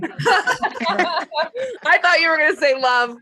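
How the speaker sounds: high, slow words through real laughter; laughing. Real, not acted. Laughing along at the guess.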